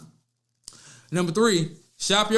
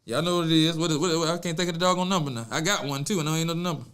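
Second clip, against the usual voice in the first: ordinary talk, nothing marked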